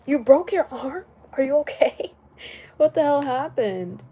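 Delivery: loud, high alarmed question; loud worried surprise; shocked concern. Real, not acted. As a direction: react as if a friend just got hurt. Reacting to chatter's injury.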